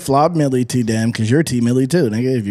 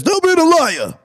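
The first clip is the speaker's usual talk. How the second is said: high, louder mock dog voice; 8 semitones higher, 3 dB louder than usual. Pretend dog scolding his owner, high mock voice. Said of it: "your dog says".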